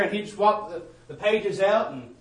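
ordinary talk, nothing marked

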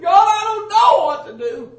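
high, loud retold cry to God. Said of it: "I began to cry out".

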